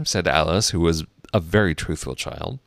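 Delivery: ordinary delivery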